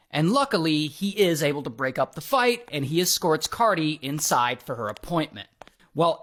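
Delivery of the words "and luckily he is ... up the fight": ordinary delivery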